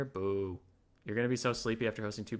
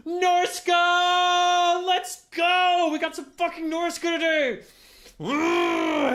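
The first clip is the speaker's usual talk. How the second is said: very high, loud cheer; hyped shout; 12 dB louder, much slower than usual. Real, not acted. Streamer cheering over the faction he drew, hyped shout. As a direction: celebrate at full volume. Excited about draft result.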